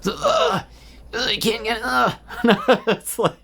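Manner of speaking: very high squeaky gremlin croak. Retold or imitated. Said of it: "I call it his, like, gremlin voice".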